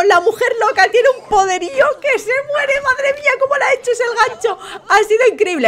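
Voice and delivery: high, breathless-with-delight gush; thrilled commentary. Real, not acted. Host marvels at the crazy woman's hook, thrilled commentary. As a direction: rave excitedly at a high pitch.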